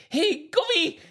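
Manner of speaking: very high, badly faked Scottish accent. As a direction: squeal out a joke Scottish greeting. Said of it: "now Scottish".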